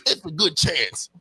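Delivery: gruff wrestling promo voice. Retold or imitated. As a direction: growl it like a wrestler cutting a promo. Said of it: "she said that shit with her wrestling voice".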